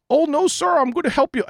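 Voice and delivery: high, sing-song customer service voice; high mock-cheerful call center rep; mock support rep. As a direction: play an overly chipper phone agent brushing him off. Imitating support rep on phone.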